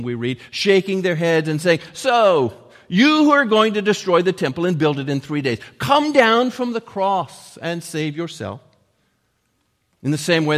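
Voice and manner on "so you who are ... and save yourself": loud, raised-pitch jeering; hurled insult. Retold or imitated. Passers-by at the cross, loud scornful jeer. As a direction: throw it out as a loud taunt. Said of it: "hurled insults at him".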